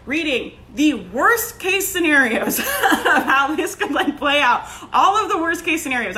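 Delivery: slow words broken by real laughter; laughing while telling. Real, not acted. Confessing her news spiral to listeners, laughing through it. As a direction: laugh through the worst case scenarios.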